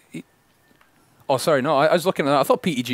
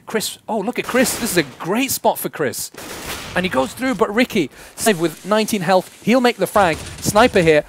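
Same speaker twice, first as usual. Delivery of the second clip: higher, rising caster hype as the round swings; excited play-by-play; 7 semitones higher, 3 dB louder than usual. Real, not acted. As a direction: call the action faster and higher, caught up in it.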